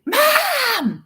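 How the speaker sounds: put-on high, loud, drawn-out kid's cry; hammed-up whiny little-girl call; imitated tattling child. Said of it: "that's how she talks".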